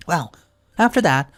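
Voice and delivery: plain reading voice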